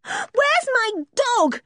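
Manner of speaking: very high, drawn-out cry; anguished girl's cry. A narrator's reading. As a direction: demand it desperately at a high pitch. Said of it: "she cried".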